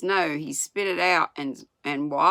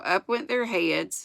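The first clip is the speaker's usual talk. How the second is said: ordinary delivery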